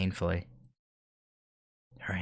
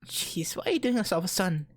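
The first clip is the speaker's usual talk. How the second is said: put-on crying girl voice; 10 semitones higher than usual. Girl voice, tearful protest.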